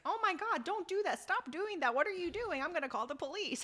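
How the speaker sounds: high, alarmed pleading; mock panicked bystander. German bystander pleading with Hyunwoo, high and panicked. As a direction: squeal it like a frightened bystander. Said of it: "poor German guy's like".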